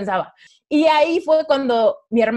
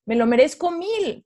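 "me lo merezco mil" jumps higher with a firm falling "mil"; emphatic affirmation; 7 semitones higher than usual. Retold or imitated. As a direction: push the repeat higher and land hard on "mil".

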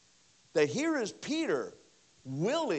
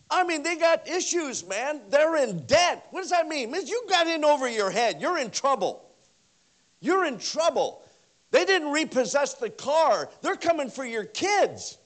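loud, high, driving sermon voice; full-force preaching; 9 semitones higher, 10 dB louder than usual. Acted. Pastor preaches loudly to the congregation about debt. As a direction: preach it hard to the back row.

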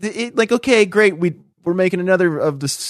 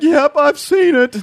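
higher, slower put-on voice of a guy nodding along; mimicking a guy; 11 semitones higher, 3 dB louder, slower than usual. Man in the audience turning to his wife, imitated higher and slower. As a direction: drawl agreement to his wife like a know-it-all. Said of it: "That's how his voice sounded".

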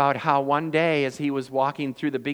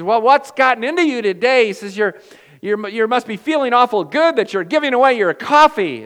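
surprised Tony; 9 semitones higher, 13 dB louder than usual. Retold or imitated. Tony voiced in retold story.

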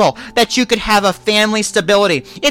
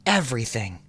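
much softer, lower, murmured to God; hushed prayer; 13 semitones lower, 18 dB quieter than usual. Real, not acted. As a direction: breathe the words as a private prayer. Hushed closing prayer words.

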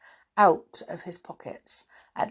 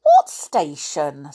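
puzzled, slowly drawn-out question; astonished boy in a children's story; 4 dB louder, slower than usual. A narrator's reading. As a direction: query it in mild disbelief. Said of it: "said Joe in astonishment".

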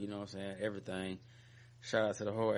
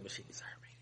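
whispering; 14 dB quieter, faster than usual. Real, not acted. Joking aside, half-hidden.

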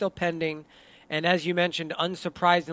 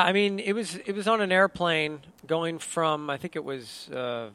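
normal talking voice

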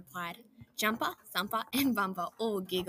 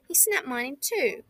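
louder complaint; upset cry; 5 semitones higher, 6 dB louder than usual. A narrator's reading. Bumper complaining to the others about his feather. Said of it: "cried Bumper".

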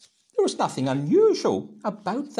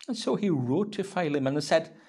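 ordinary talk, nothing marked

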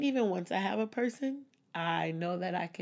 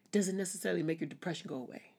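ordinary talk, nothing marked

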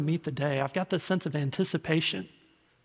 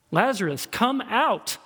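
raised, ringing summons; commanding call; 6 semitones higher, 4 dB louder, slower than usual. Acted. Jesus calling dead Lazarus from the tomb, commanding. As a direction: call out high and firm into a tomb.

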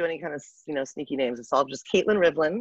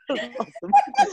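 laughing; 3 dB louder, slower than usual. Real, not acted. Laughing at the tattoo story.

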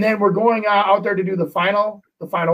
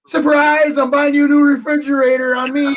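bright and up, springing the news; mock-grand, higher surprise reveal; cheerful surprise announcement; 7 semitones higher, 3 dB louder than usual. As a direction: announce it like a gift. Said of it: "And I said".

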